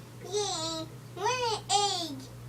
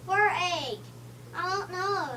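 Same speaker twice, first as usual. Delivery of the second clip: ordinary talk, nothing marked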